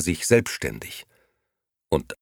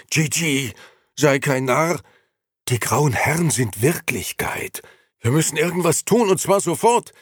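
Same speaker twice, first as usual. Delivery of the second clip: higher, louder pleading urgency; urgent old Beppo; 10 semitones higher, 5 dB louder than usual. Acted. Beppo gripping Gigi by the lapels, urgent and louder. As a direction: shake him awake with the words. Beppo shaking his friend.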